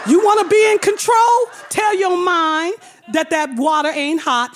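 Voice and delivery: mock commanding yell; commanding shout. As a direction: drill sergeant order. Pedicurist scolds her.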